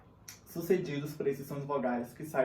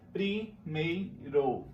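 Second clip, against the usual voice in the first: "primeiro" bem lento, em três sílabas; sílabas separadas; much slower than usual. Shown as a demonstration. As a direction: separe cada sílaba de "primeiro", com pausas. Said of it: "encontros vocálicos não se separam". ("primeiro" silabado devagar)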